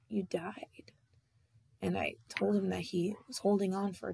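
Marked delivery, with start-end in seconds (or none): 0.1-0.9 soft unvoiced whisper, barely there. To her dead dad in the dream, whispered. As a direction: breathe the bad news out gently.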